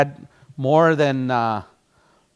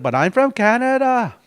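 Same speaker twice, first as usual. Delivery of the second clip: higher, louder, exaggerated northern Canadian accent; 8 semitones higher, 6 dB louder than usual. Teacher repeating the joke in a northern Canadian accent. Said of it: "Real wicked northern accent".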